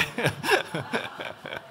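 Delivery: airy chuckle at own joke; light laugh. Real, not acted. Preacher chuckling at youth-service joke. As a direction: laugh it off softly.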